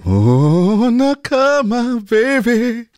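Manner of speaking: very high, stretched-out catchphrase; drawn-out hype. Shown as a demonstration. Guest hams up the drop for the DJ, drawn-out hype.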